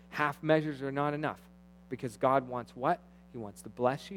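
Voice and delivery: usual speaking voice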